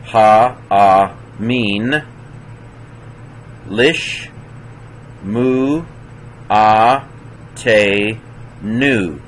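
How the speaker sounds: syllable by syllable with clear gaps; sounded out. Shown as a demonstration. Teacher reading Isaiah for students to follow, sounded out. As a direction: read it out one careful syllable at a time. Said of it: "I thought I would do it".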